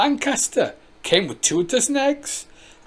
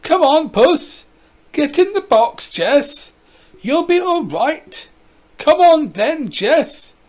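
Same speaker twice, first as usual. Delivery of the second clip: calling out; 9 semitones higher, 5 dB louder, slower than usual. Acted. Calling cat down from tree.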